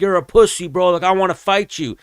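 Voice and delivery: hammed-up trash talk; imitating a heckler calling him out; taunting troll. Troll taunting fighter on escalator.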